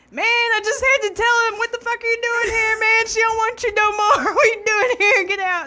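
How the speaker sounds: high, loud mock tough-guy voice; imitating Randy telling Nick off. Uncle telling the ex to get out, high loud mock tough voice. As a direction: play the protective uncle yelling him out. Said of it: "And he's like".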